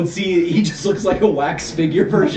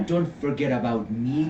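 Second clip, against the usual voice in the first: seductive call; 6 semitones lower, 6 dB quieter than usual. Retold or imitated. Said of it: "you hear".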